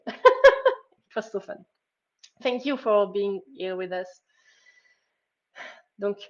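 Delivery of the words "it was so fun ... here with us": real laugh, much louder and slow. Real, not acted. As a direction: laugh through the words, delighted. Laughing while thanking her friend.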